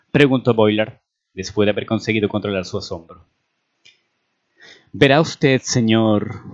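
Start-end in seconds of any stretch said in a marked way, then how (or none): none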